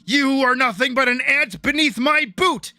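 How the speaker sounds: mock a pompous loser trying to sound tough; bad villain line. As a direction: overblown fake threat from a weak villain. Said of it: "really shitty dialogue where he, he's kinda stumbling".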